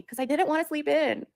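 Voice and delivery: high, loud little-boy whine, imitating him; mock child pout; whiny kid voice. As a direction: play the grumpy ten-year-old complaining. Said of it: "And we're like".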